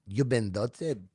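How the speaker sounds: soft mock French accent; understated comic French. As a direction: say it quietly with a French lilt. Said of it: "like, in a French voice".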